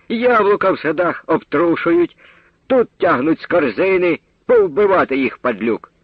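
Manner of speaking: high, indignant woman's rant; angry market woman. A narrator's reading. Market woman raging at the thieving boy. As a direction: spit it out in outrage.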